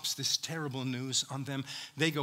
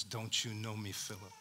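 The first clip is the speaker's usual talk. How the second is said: very soft, lower, gentle; tender; 6 semitones lower, 10 dB quieter than usual. Acted. Preacher voicing Jesus gently asking Philip, tender and soft. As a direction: ask it gently, almost hushed.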